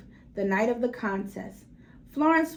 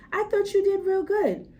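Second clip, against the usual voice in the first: 1 proud father; 8 semitones higher, 4 dB louder than usual. Acted. Father comforting daughter.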